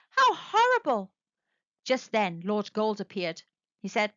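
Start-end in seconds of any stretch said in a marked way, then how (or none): 0.1-1.1 high horrified cry. A narrator's reading. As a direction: gasp it out in horror. Narrator's horror at discovery.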